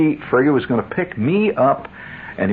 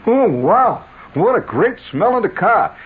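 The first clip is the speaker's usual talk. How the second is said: casual everyday guy; 11 semitones higher, slower than usual. Retold or imitated. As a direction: play the ordinary commuter, enthusing about the smell. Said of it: "he didn't talk like that".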